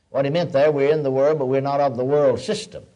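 usual speaking voice

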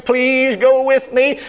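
very slow, high, hammed-up crying whine; mock whining plea. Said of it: "instead of whining and crying".

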